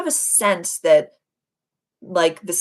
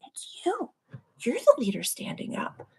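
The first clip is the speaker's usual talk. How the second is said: soft whisper; 6 semitones higher, 6 dB quieter than usual. Real, not acted. Telling viewers they lead.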